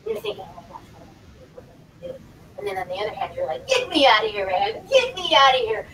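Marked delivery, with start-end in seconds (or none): 0.4-2.2 very soft, fretting to oneself; barely voiced inner panic; acted private worry. Acting out worry at layoff rumors. 3.7-5.9 loud, emphatic wish to escape; acted urgent plea. Acting out wanting to escape job.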